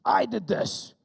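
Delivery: put-on high, loud kid's declaration; voiced child's unapologetic shout; imitated defiant daughter. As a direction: own up boldly like a little kid. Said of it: "She was like".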